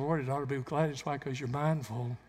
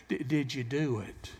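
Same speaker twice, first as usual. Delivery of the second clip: ordinary talk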